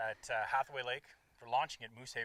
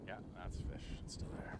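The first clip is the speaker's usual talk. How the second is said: whispering; 6 semitones lower, 6 dB quieter than usual. Real, not acted. Tense fight with fish.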